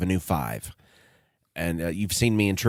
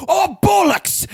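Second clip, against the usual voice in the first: much higher, loud, slow British shout of frustration; British exclamation; 6 dB louder, slower than usual. Retold or imitated. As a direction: blurt it out in a British accent as the mask slips. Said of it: "he just goes".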